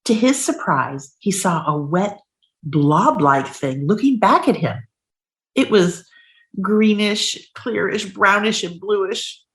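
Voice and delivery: expressive storybook narration, dramatic pauses; reading to children. Acted. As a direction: draw out the gross reveal. Reads picture book aloud.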